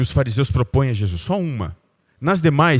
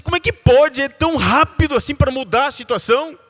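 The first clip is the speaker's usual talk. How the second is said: higher, amazed wondering; 10 semitones higher than usual. Retold or imitated. A father amazed at his son's quick forgiveness, higher pitched.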